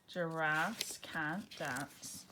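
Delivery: each word drawn out slowly; slow title reading. Shown as a demonstration. Title announced before reading.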